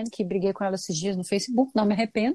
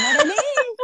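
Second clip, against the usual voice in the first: high, loud shouted call of a name; shouting a name; 13 semitones higher, 5 dB louder than usual. Shown as a demonstration. Showing how she yelled for her friend at the gate, high loud shout. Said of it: "porque eu falava assim".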